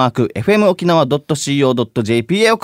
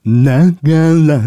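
[name] impression; 4 dB louder than usual. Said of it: "ガクトさん。".

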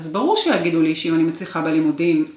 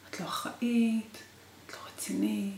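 hushed, unvoiced retelling of his yelling; retold yelling; 3 semitones higher, 9 dB quieter than usual. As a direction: whisper the scolding instead of shouting it. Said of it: "התחיל לצעוק עליי". (לחישה במקום צעקה)

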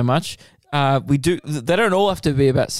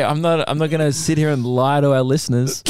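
ordinary talk

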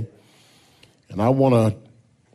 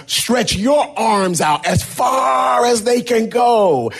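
much higher, shouted; children shouting; 11 semitones higher than usual. Acted. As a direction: kids yelling up at the teacher. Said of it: "And so they said".

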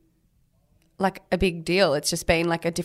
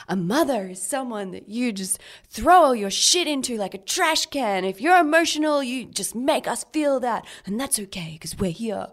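exaggerated sarcastic recital, swelling then easing off; sarcastic imitation; 6 semitones higher than usual. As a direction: imitate a sarcastic dancer, pitched well up. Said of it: "in like, a pretty sarcastic way".

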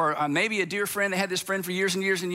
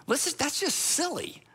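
higher, impatient advice voice; exasperated outsider; 6 semitones higher than usual. Retold or imitated. Preacher voicing an outsider's impatient inner advice, higher. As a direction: scoff at how silly the feud is. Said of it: "you think to yourself".

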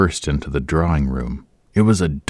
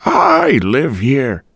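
slow, high, dignified; dignified old woman; 8 semitones higher, 4 dB louder, much slower than usual. A narrator's reading. Landlady answering Boyce with slow dignity. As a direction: draw yourself up slowly. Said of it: "she said with dignity".

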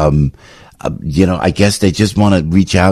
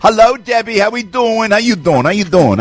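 high cheery greeting; 10 semitones higher than usual. Real, not acted.

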